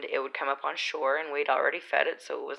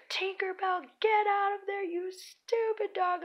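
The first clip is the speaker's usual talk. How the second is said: high-pitched mock yell, words stretched out; retold yelling; slower than usual. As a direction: call the puppy out in a high, drawn-out cry. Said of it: "I'm yelling at her".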